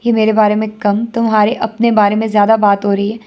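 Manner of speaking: ordinary delivery, nothing marked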